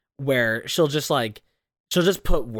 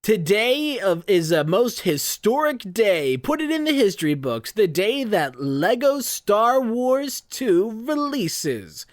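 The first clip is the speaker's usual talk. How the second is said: grand comic announcer; 7 semitones higher, slower than usual. Retold or imitated. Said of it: "It's like".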